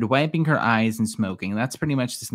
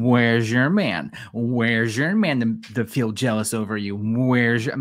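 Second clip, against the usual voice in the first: drawn-out sassy taunt; put-on slow, needling question; sassy mocking. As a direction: stretch it out to mock her. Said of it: "she gave a little sassy look".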